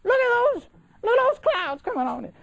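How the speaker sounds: very high-pitched mock Cajun woman; hammed-up Cajun lady hollering, way up high; Cajun accent impression. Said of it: "butcher a Cajun voice".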